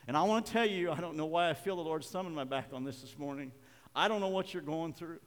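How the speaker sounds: ordinary talk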